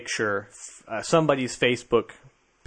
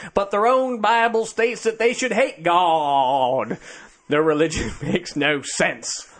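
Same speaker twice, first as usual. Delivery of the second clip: put-on southern accent; 7 semitones higher than usual. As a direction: read the post like a Southern zealot. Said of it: "You gonna use your southern accent on this one?".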